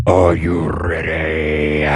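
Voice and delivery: very low, slow German frontman voice. Retold or imitated. Imitating the Rammstein singer greeting a concert crowd, low and slow. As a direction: address the crowd deep and slow like a metal singer. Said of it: "his voice is not like that all the time".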